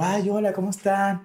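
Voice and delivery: high-pitched, singsong Venezuelan greeting; super Venezuelan accent. Retold or imitated. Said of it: "Con mi acento súper venezolano".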